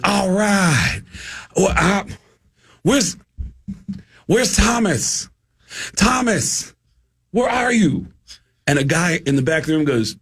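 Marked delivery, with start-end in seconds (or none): 0.0-8.1 high, halting stoner-comic drawl; stretched words with long gaps, pitched up; Mitch Hedberg. Retold or imitated. Comedian searching the crowd for Thomas, high halting drawl. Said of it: "Mitch gets up and he goes".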